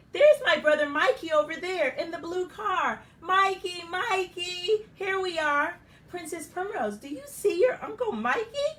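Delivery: high and loud, calling across the car park; excited calling out. Acted. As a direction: wave and call to your brother. Mother calling to her brother.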